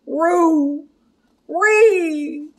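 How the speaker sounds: high, drawn-out doggy counting; cartoon dog's "two, three"; Scooby-Doo. A narrator's reading. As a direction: count off like a talking cartoon dog. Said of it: "he counted".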